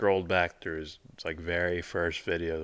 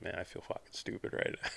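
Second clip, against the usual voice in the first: muttered under breath; 8 dB quieter, faster than usual. Retold or imitated. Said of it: "I'm like".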